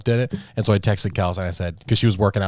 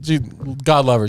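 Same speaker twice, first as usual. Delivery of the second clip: ordinary talk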